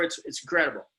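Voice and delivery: ordinary talk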